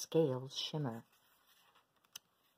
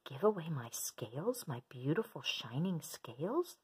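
whispering. Acted. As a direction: breathe it in disbelief. Shocked whisper.

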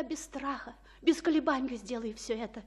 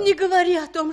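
desperate cry; 6 semitones higher, 14 dB louder, faster than usual. Acted. Juliet begs the friar.

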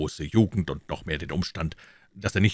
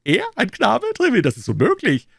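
loud, high-pitched disbelief; incredulous king; 7 dB louder than usual. Acted. King disbelieving Treville about the boy, incredulous loud outburst. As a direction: burst out amazed in a high royal voice.

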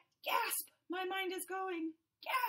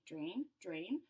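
"Drain, drain" low and soft; low murmur; 10 semitones lower, 6 dB quieter than usual. Acted. Fish chants drain.

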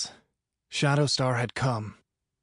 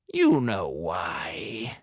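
smug villain; 5 semitones lower, much slower than usual. A narrator's reading. Villain answers captive.

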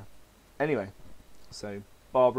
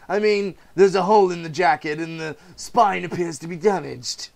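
higher, louder mocking villain voice; sneering villain, pitched higher and louder; gloating Joker; 9 semitones higher, 8 dB louder than usual. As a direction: savour every cruel joke. Joker taunts Gordon.

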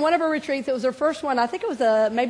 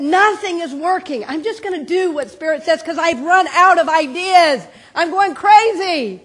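higher, louder, slow retelling of despair; retold outburst; 5 semitones higher, 9 dB louder, slower than usual. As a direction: act out a frantic friend, half-mock. Friend's desperate outburst retold.

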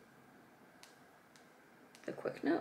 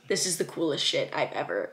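ordinary talk, nothing marked

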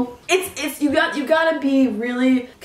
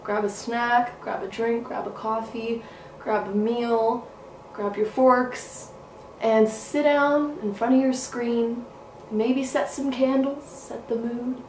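soft inviting host; 5 dB quieter, slower than usual. Acted. Inviting viewers to settle in.

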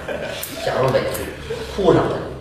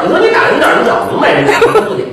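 normal talking voice

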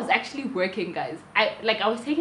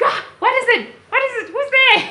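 alarmed excitement; 9 dB louder, faster than usual. Retold or imitated. Wife startled, husband jumps up.

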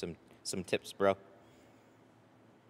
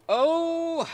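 sudden high startled yelp; surprised; 8 dB louder than usual. Real, not acted. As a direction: caught off guard, yelp it out. Sudden startled exclamation on stream.

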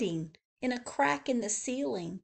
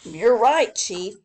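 louder, agreeing helper; agreeing keeper Joe; 9 dB louder than usual. Acted. Joe agreeing with his chief, louder. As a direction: nod along as Joe. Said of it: "said Joe".

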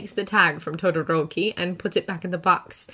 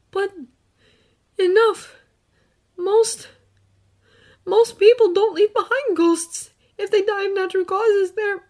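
put-on earnest boy, gently recounting; young hero voice; 9 dB louder than usual. Izuku explains ghosts.

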